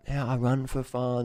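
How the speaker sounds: mock hobby runner. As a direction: imitate a carefree hobby runner, slowly.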